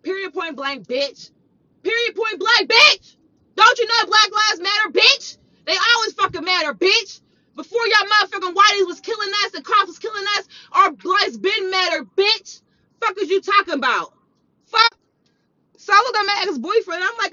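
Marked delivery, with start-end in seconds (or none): 1.8-3.2 higher, louder, ending in a shout; angry escalation. Retold or imitated. Escalating repeat of the retort. 3.5-15.0 raised voice, punching each insult; angry rant. Retold or imitated.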